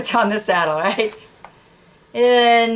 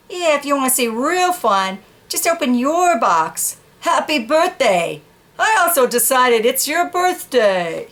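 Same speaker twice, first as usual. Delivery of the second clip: excited dog; 5 semitones higher than usual. Acted. Dog proudly giving the horse its box, high. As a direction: make it a big surprise.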